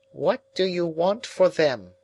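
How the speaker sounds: high, thin, quietly probing; quiet old woman. A narrator's reading. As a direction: ask it softly, as an old woman. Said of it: "said quietly".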